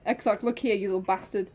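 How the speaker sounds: ordinary delivery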